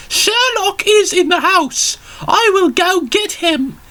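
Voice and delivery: put-on very high, slow falsetto old lady. Old lady answers at Sherlock's door, very high and slow. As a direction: squeak it out like a tiny old granny.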